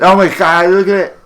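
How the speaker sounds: high, loud nerd squeal. Shown as a demonstration. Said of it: "Do it again".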